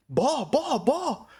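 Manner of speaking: high urgent call; warning shout. Retold or imitated. Fans warning of the incoming ball, high urgent shout.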